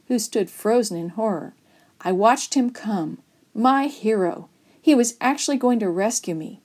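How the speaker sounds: ordinary delivery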